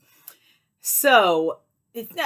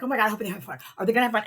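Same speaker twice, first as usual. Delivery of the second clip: retold flustered worry, raised and hurried; freaking out, retold; 4 semitones higher, much faster than usual. Seller fretting over new customers, mock freak-out. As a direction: fret aloud like a nervous host. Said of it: "I started freaking out".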